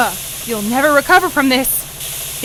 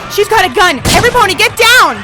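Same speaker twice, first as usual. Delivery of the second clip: shouted warning, much higher and louder, fast; alarmed shout; 9 semitones higher, 8 dB louder, faster than usual. Acted. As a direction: yell at everyone to get down. Gunman threatens, warns everyone.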